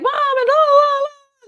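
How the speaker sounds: put-on high, squealing teen joy over her license; excited cheering. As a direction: squeal it out like a thrilled teenager. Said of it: "and cheering".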